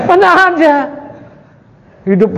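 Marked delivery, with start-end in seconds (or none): 0.1-0.7 high, loud, disbelieving; incredulous. Real, not acted. Teacher dismissing the indifferent husband, incredulous and high. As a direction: scoff at the idea in disbelief.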